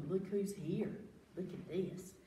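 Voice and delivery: ordinary delivery, nothing marked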